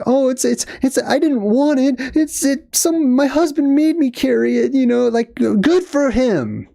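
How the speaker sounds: hammed-up frightened woman justifying herself; nervous excuse. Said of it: "And she's like".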